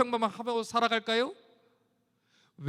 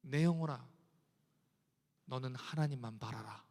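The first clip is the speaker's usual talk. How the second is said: soft, much lower, inward plea to the soul; hushed; 10 semitones lower, 9 dB quieter than usual. Acted. Preacher quietly tells his own soul to hope in God. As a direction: speak it softly to your own soul. Soft then loud repeat.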